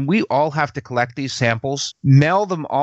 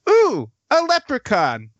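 higher, wide-eyed delighted ooh; playful mock wonder; delighted surprise; 10 semitones higher than usual. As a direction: coo at a tiny magical creature. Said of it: "Erica looks down and goes".

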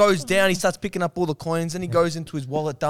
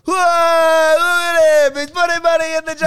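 high, loud, drawn-out cheer; mock cheering shout; 11 semitones higher, 6 dB louder, slower than usual. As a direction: hype him up like a crowd, shouting. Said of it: "You guys keep going like".

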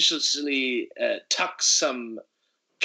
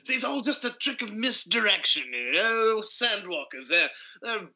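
a touch higher, easy boastful tone; lightly voiced swagger; 7 semitones higher than usual. Hero explaining his trick, lightly voiced swagger. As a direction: explain it like a smug showman. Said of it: "I say as we walk up".

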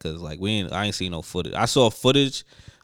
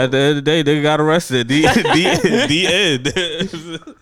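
loud, high, talking through laughter; laughing; 6 semitones higher, 8 dB louder, slower than usual. Real, not acted. Podcaster cracking up telling his co-host the doc's ending, laughing. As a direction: crack up while getting the words out.

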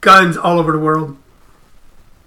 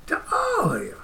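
much higher, softer "To all of you"; silly high voice; 12 semitones higher, 14 dB quieter than usual. Pretend. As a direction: squeak the farewell up high. Repeated farewell in silly voice.

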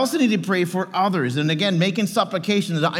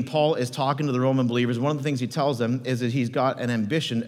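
usual speaking voice

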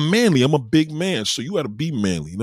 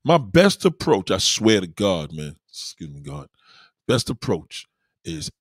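usual speaking voice

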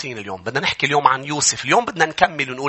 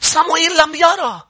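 rising, higher and louder preaching that ends in shouts; preaching at full force; 12 semitones higher, 4 dB louder than usual. Acted. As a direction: drive the sermon up to a fervent peak. (وعظ بحماس متصاعد)

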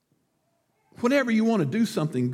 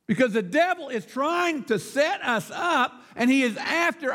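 higher, projected sermon delivery; emphatic preaching; 9 semitones higher than usual. Real, not acted. Preacher urging Christians to speak up, emphatic. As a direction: drive each phrase home to the congregation.

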